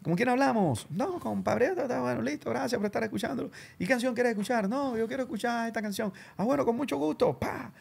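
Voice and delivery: high, bright mock DJ voice, imitating a radio host taking a caller; playing at radio host for callers. Kid playing DJ, mock radio host voice.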